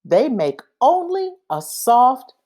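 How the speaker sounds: plain reading voice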